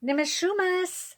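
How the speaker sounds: urgent call, pitched high; calling out frantically through the forest; frantic call. A narrator's reading. Girl searching for great-grandfather, frantic call. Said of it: "I call frantically".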